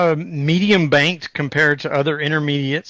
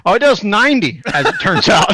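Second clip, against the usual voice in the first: laughing; 10 semitones higher, faster than usual. Retold or imitated. Laughing retelling answer to coworkers.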